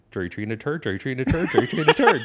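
cracking up as he repeats the joke; laughing punchline. Acted. Comedian cracking up at his own punchline, building laughter.

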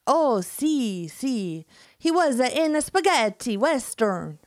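hammed-up Italian accent, high and loud. Enzo upset, in a hammed-up Italian accent. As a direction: mamma mia in an over-the-top Italian accent. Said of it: "amazing skills for accents".

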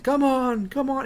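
high, strained acted plea; pleading urging. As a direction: urge a spent friend on. Acted urging at tearful climax.

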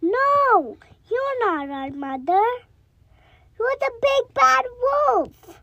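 put-on high, loud little kids; goat kids voice. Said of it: "The children said".

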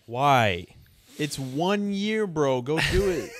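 loud, high, slow, emphatic; incredulous urging. Real, not acted. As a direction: push him hard to take the deal. Urging player to take money.

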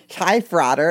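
chirpy frat-bro hello; imitating a frat guy. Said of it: "I remember one of them being like".

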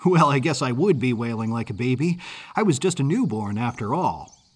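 usual speaking voice

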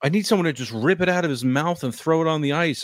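high exasperated complaint; exasperated whine. Real, not acted. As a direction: whine about how annoying it is. Ranting about the mouth guard.

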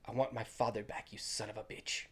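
a hint of Inigo's fury, half whispered; hushed intense quote. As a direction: murmur it with restrained vengeance. Retelling Inigo's final line.

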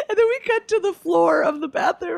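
high, laughing through the words. Real, not acted. Laughing as she describes the bathroom scene.

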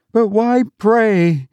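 high, slow terrified scream; frantic scream. A narrator's reading. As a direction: scream it in bewildered terror. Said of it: "screamed the girl".

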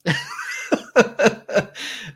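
loud burst of laughter; big laugh. Real, not acted. Laughs at the DoorDash joke.

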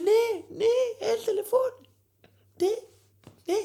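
very high, slowed, squealing fan voice; hammed-up squealing fan; mock crowd cheer. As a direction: squeal like a thrilled clubber. Mocking crowd cheering the song.

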